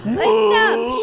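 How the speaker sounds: loud furious shout. Acted. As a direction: yell it in fury. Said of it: "shouts Rochelle".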